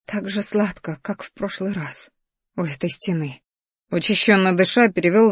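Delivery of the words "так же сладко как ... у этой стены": very soft, intimate request; tender, hushed enticement; seductive hush. A narrator's reading. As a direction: breathe it close to a lover. Lover asks softly for kiss.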